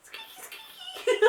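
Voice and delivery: very high squeaky voice with a laugh; squeaky noise. Shown as a demonstration. Owner showing attention noises for a dog, squeaky high voice. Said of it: "you can ... squeaky".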